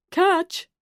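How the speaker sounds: high, loud call; shouted catch. A narrator's reading. Woman throwing loo paper over the stall, loud high call. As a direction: fling the word with the roll.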